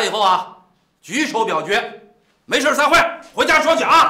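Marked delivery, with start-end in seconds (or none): 2.5-4.1 loud, high announcement over chatter; raised voice closing a rowdy meeting; calling out dismissal. Acted. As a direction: send the crowd home at full voice. Dismisses the noisy village meeting.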